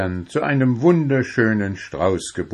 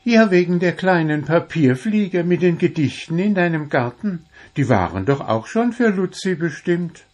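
much higher, playful goblin friend; Wuschel, teasing; 9 semitones higher than usual. A narrator's reading. Wuschel asks Puh back about the roses, high and teasing. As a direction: ask it back with a grin.

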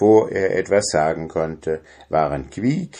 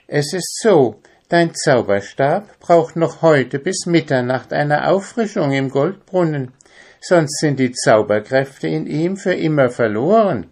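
lightly voiced animal, higher pitch; Quickie explaining earnestly; 6 semitones higher, 3 dB louder than usual. Quickie explains the wand's danger, higher earnest voice. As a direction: lay out the warning, pitched up.

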